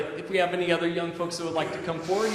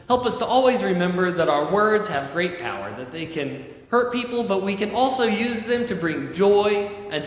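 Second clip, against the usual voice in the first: louder, earnest prayer to close; 5 dB louder than usual. Acted. Pastor praying aloud over the children, loud and earnest. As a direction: lift it up as a heartfelt prayer.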